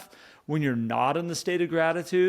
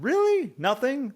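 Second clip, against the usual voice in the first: incredulous; 9 semitones higher, slower than usual. Retold or imitated. To grocery clerk who said nothing good happened, incredulous. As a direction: push back in playful disbelief. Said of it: "And I said".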